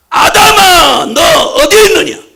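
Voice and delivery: loud shouting call, pitched high; God shouting for a hidden Adam. Acted. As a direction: call out across the garden for Adam.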